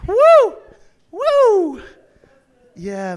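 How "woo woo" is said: high, loud whoops; real charismatic woo; ecstatic whooping. Real, not acted. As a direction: let the joy burst out in whoops. Ecstatic worship outburst.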